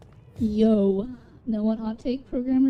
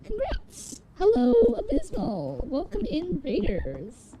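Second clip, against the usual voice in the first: cute anime streamer; 6 semitones higher than usual. Acted. Greeting incoming raiders on stream.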